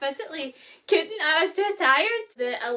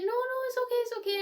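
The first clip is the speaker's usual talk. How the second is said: much higher, sing-song "it's okay" voice; mock reassuring lash girl; 12 semitones higher than usual. Lash girl telling coughing client it's fine, high reassuring imitation. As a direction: chirp the lash girl's soothing reply.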